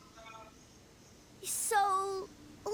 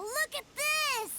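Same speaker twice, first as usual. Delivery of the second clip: excited; 5 semitones higher, slower than usual. Acted. Kid thrilled by a toy gift.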